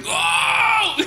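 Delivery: high mock holler; imitated sudden "whoa" holler. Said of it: "this guy's, like, hollering".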